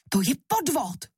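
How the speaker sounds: high, forceful devil's outburst; outraged cry. A narrator's reading. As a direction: cry foul as the cheated devil. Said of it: "vykřikl".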